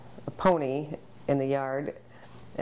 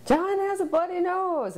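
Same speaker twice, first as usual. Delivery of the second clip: high-pitched imitation of a screaming girl; retold scream; 14 semitones higher, 3 dB louder than usual. Neighbor girl runs in with news of the injury, mock scream. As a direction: cry it out high, mimicking a panicked kid. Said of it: "runs in just screaming".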